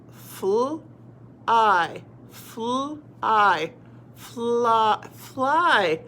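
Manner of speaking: held FL, then long I, blended into FLY; sounding out. Shown as a demonstration. Teacher trying out a short word, sounded out. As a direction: sound out FL and I apart, then join them. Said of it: "let's try it out".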